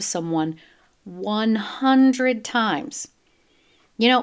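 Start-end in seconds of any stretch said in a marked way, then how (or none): 1.0-3.1 very slow "one hundred times?", stressed on "hundred"; drawn-out disbelief. Real, not acted. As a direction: stretch every word in disbelief. Outraged at the stab count.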